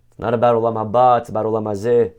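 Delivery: normal talking voice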